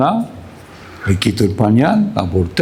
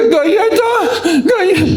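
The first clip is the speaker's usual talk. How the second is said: very high, drawn-out mock wail; slow squealed tantrum plea; crying toddler; slower than usual. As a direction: imitate a little girl whining tearfully. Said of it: "no llores".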